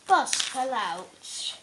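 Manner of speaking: plain reading voice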